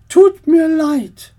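aunt character voice. Acted. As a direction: speak as the fussy aunt, drawn out.

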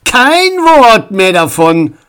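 loud, slow, high warning; sharp. A narrator's reading. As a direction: shut the topic down sternly. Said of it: "sah seinen Sohn scharf an".

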